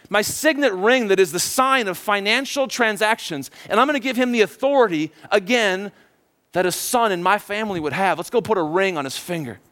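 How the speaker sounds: acted urgent, joyful calling; yelling to servants. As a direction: call out eager instructions, pitch up. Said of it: "he yells to his servants".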